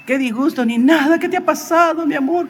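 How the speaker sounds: high-pitched, fussing imitation of a wife; retold wifely concern. Wife greeting her injured husband at home, fussing concern.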